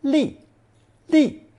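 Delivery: 利 starts very high and falls; high falling tone on each 利; fourth tone. Shown as a demonstration. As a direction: say 利 crisply in fourth tone, from a high pitch. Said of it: "Pronounced fourth tone".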